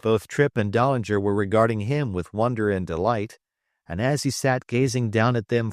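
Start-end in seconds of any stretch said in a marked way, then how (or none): none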